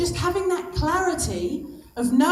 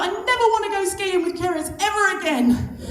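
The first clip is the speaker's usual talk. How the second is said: hammed-up dismay, pitched well up; mock exasperated outburst; 7 semitones higher than usual. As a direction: act out an exasperated inner wail. Retold reaction to instructor's plea.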